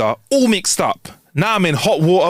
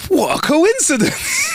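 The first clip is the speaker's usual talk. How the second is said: knowing smile; 14 semitones higher, slower than usual. Acted. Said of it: "She smiled".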